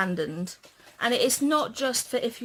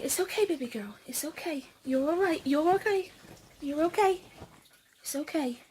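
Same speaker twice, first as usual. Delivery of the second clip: high, tender reassurance; soothing; 6 semitones higher, faster than usual. Real, not acted. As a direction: reassure a panicking pet. Comforting a scared pet.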